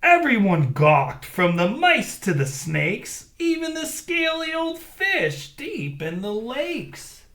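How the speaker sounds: lively read-aloud storytelling; story narration. A narrator's reading. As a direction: read it big for the kids. Reads story to children.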